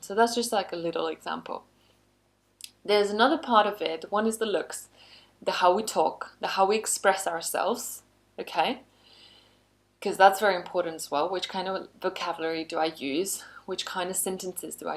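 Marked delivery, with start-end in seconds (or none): none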